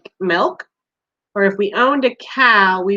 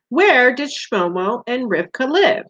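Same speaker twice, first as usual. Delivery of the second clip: ordinary delivery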